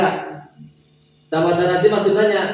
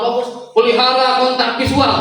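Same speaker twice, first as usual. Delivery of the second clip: raised intonation demo; 7 semitones higher than usual. Shown as a demonstration. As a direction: lift the pitch to grab focus. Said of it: "Lamun di-, ditinggikan". (nada ditinggikan dan lebih keras)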